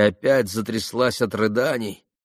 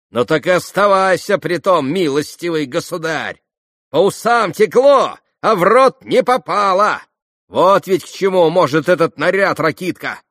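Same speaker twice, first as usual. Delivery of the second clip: high, loud, gloating scorn; vicious mocking crow; spiteful taunt; 8 semitones higher, 7 dB louder than usual. A narrator's reading. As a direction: throw it at him maliciously. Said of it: "Закончила Грушенька со злобным смешком".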